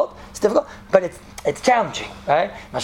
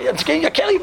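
high-pitched comic plea; mock fearful protest; 9 semitones higher than usual. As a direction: plead in a high, exaggerated voice. Pupil protests during painful exercises.

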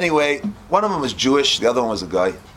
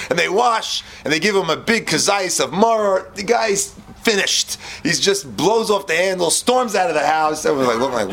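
ordinary talk, nothing marked

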